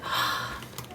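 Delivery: quiet breathy gasp of awe; soft gasp. Real, not acted. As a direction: catch your breath in wonder. Reaction to the pen box.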